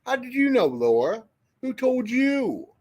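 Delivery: high-pitched, startled questioning; incredulous. Real, not acted. Streamer asking a viewer how she already knows, incredulous.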